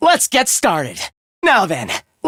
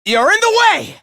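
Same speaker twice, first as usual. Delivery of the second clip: high-pitched, urgent order; battle shout; 9 semitones higher than usual. Acted. Fighter to someone blocking the way, urgent shout. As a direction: call out sharply over a fight.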